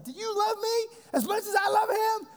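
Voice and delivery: high, tearful cry, voice breaking; crying to dad. Retold or imitated. New father asks his dad through tears, crying out. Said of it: "And I'm just bawling, I'm crying".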